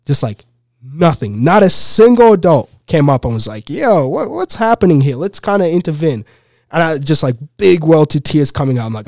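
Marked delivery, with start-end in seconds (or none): 3.7-6.2 high-pitched mock bystander; imagined concerned bystander. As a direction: voice an onlooker stepping in, pitch raised.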